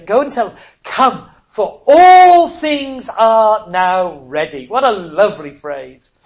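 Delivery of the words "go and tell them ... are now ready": proclaiming invitation. Acted. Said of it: "it says here".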